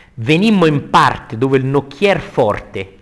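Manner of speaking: loud, slow declaiming; verse recitation. Acted. Teacher rereading the ferryman's arrival, loud slow declamation. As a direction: project it with drawn-out weight.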